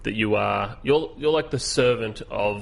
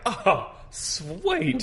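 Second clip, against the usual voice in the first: excited; 11 semitones higher than usual. Retold or imitated. His own excited reaction retold.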